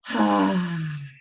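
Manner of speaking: loud open-mouthed sigh out; demo sigh. Shown as a demonstration. Breathwork teacher showing the class a loud release sigh. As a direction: push the breath out in a big audible sigh. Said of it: "So we're gonna try again".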